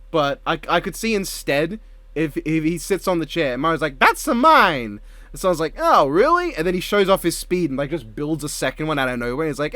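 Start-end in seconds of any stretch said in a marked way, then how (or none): 4.0-5.0 high, drawn-out Italian cartoon accent; Mario Italian accent. Voicing Mario claiming his chair. 5.8-6.5 high, teasing cartoon lilt; Sonic mock surprise. Voicing Sonic reacting to Mario.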